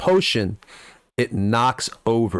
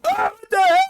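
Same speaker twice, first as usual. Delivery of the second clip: high yelp of outrage; startled wizard cry; alarmed yell; 3 dB louder than usual. Acted. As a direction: shout it from up the stairs. Turan yelling upstairs.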